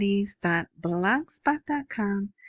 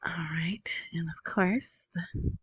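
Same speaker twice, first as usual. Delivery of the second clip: softer, hushed aside; whisper; 7 dB quieter, slower than usual. Real, not acted. Host quietly moves on between announcements, whispering. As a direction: drop to a whisper as if confiding.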